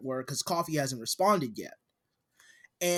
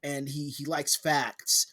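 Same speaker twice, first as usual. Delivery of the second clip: ordinary delivery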